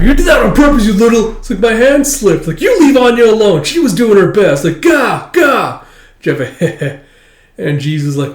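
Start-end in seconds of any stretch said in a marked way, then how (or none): 0.0-1.4 put-on high, loud accusing kid shout; angry kid. Kid yelling at the thrower, high angry shout. 1.6-2.5 lightly voiced kid making an excuse; high kid excuse. Kid excusing the throw, higher voiced. 2.6-4.6 put-on high, loud scolding kid; protective kid. Girl defending Anya from the boy, high loud scolding. 4.8-5.8 put-on high, loud kid frustration; frustrated gah. Flustered boy sputtering gah, high and loud.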